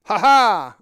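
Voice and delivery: loud, acted two-beat laugh; hammed-up "ha ha" laugh. A narrator's reading. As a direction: laugh out big and hearty. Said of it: "I laughed".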